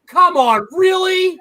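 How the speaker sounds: high, incredulous protest; mock-exasperated plea to the lights; retold exasperation. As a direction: pitch climbing into disbelief.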